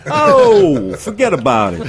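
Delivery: thick New York accent, hammed up. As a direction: toss it off like an old New Yorker. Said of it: "the New York accent is disappearing".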